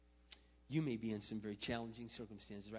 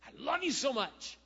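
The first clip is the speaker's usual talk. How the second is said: movie romance; 14 semitones higher, 12 dB louder than usual. Pretend. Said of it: "we're just".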